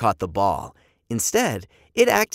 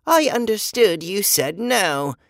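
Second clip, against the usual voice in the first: boy imitating a robot, higher stilted voice; Adam; 8 semitones higher, 4 dB louder than usual. A narrator's reading. Boy mimicking the robot for his family, robot voice. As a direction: play at being a literal robot. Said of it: "in his best Adam voice".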